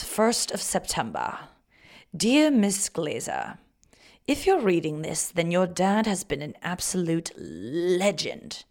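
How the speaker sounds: slow, put-on British reading; British accent. Reading an admirer's letter to listeners, slow British accent. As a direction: read it as a British gent, unhurried.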